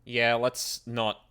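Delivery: loud, slow refusal. Real, not acted. Player refusing with emphasis.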